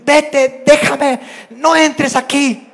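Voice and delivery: high, loud commands; siblings shouting. Retold or imitated. Child orders sibling out of the room, shouting. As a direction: yell to drive a sibling out. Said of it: "gritándose".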